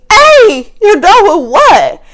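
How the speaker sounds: high, loud, slow mock challenge. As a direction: hurl it back as the son. Son challenges coworker in retelling.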